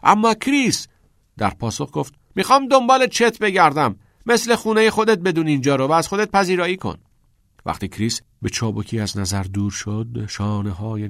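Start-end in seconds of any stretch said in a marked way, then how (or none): 0.0-0.9 high, loud protesting shout; raised, urgent call; shouting over music. A narrator's reading. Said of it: "از میان انفجار صدای موزیک داد زد". 2.3-7.0 high, loud shout over party noise; loud, high-pitched party holler; shouting back. A narrator's reading.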